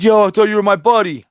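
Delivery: high, loud whiny mock kid; wannabe thug kid. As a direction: play the hurt tough-guy kid. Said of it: "He's like".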